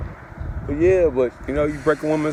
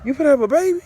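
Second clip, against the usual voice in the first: surprised mom; 13 semitones higher, much faster than usual. Retold or imitated. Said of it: "she like".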